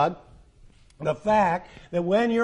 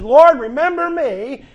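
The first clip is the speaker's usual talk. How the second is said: louder, higher, slow plea of "Lord, remember me"; imitating a cry; 7 semitones higher, 10 dB louder, slower than usual. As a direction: cry it out like the dying thief begging. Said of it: "this guy cries out to the Lord".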